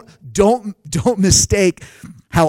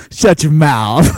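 slow, louder stunned "shut your mouth"; stunned disbelief; 6 dB louder, much slower than usual. Retold or imitated. Pastor retelling eye surgery news, stunned disbelief. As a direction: let the shock hit every word.

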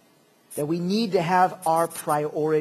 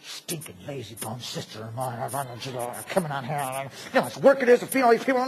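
grumbling Martha; 6 semitones lower than usual. Acted. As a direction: grumble resentfully under your breath while working. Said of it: "you can just see Martha's face".

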